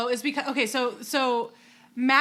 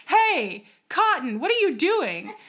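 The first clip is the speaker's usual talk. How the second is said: calling down; 6 semitones higher, 3 dB louder, slower than usual. Retold or imitated. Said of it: "and goes".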